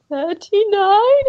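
high, slow, struggling little answer; mock strangled victim; feeble reply. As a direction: squeeze the number out like you've just been stabbed. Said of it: "the guy's trying to say".

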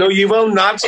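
high-pitched mock refusal in Traveller character. Guest refusing to be filmed by the interviewer, high Traveller character voice. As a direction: refuse playfully, voice pitched right up.

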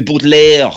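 BOUTELIER said as a French name; French pronunciation. Shown as a demonstration. Comedian explaining a Waterford surname's roots, "Boutelier" in mock French. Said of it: "would come from France".

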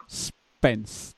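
quiet, low, forlorn murmur of the name; mournful murmur. Retold or imitated. Fan doing housework, murmuring the name for no reason. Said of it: "just gone".

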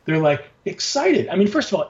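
ordinary delivery

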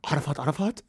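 disbelieving echo of the lawyer's name; incredulous. Retold or imitated. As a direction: question the doubled name in disbelief. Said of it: "make me stop crying and go".

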